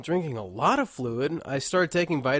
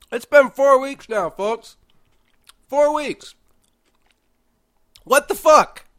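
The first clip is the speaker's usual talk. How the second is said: much higher, louder frustrated cry; exasperated outburst; 12 semitones higher, 11 dB louder than usual. Real, not acted. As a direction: vent at being sick for weeks. Fed up with long illness.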